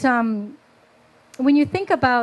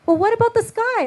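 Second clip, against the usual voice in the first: put-on child's question; 10 semitones higher than usual. Child asks her mother.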